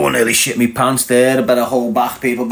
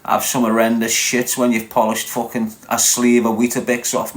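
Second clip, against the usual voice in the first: ordinary talk, nothing marked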